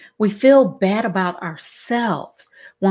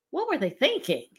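retold disbelief; 6 semitones higher, 8 dB quieter, faster than usual. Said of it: "my first question was".

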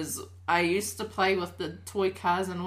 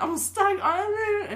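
put-on high, wailing little kid; imitated child's cry for help; mock crying; 13 semitones higher, 4 dB louder than usual. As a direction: whine it like a stuck, crying child. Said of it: "I cried 'cause I was like".

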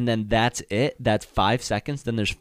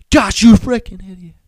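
very loud, high mock-furious shout; yelling at himself; 9 semitones higher, 14 dB louder than usual. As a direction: burst out berating yourself behind the door. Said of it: "he was yelling at himself".

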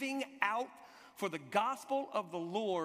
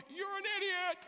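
high, drawn-up mock yell; yelling back; 12 semitones higher than usual. Friends to the man pushing back through crowd, yelling. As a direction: call it back high, imitating annoyed friends. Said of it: "yelling back at me going".

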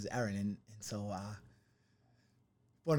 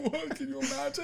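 high laughing disbelief; 4 dB louder than usual. Real, not acted. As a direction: laugh out the question.

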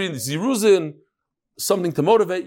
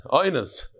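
ordinary delivery

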